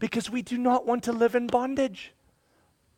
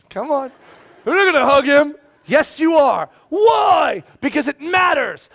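high, shouted back-and-forth; loud bickering; 6 semitones higher, 9 dB louder than usual. Acted. Father and kids arguing over hugging, loud bickering. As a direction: argue it out at a shout.

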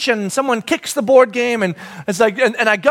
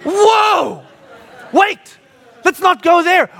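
mock alarm; 7 semitones higher, 3 dB louder, much slower than usual. Real, not acted. Preacher stops himself.

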